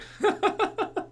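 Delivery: a long, high giggle; can't hold back the laughter. Real, not acted. Vlogger laughing at the English kid line.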